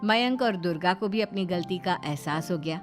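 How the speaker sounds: ordinary delivery, nothing marked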